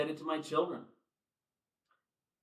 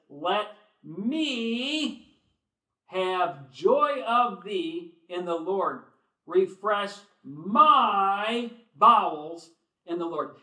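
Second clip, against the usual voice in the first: slow, drawn-out, louder scripture reading; preaching at full force; 5 semitones higher, 10 dB louder, much slower than usual. Acted. Preacher pleading Paul's words to the congregation, drawn out. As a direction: stretch out every word of Paul's plea.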